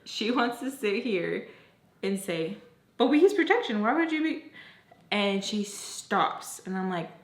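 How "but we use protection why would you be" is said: higher, louder mimicked protest; protesting friend. Friend defending herself to her, protesting. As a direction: push the quote up, indignant.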